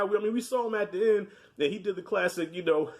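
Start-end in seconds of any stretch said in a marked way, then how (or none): none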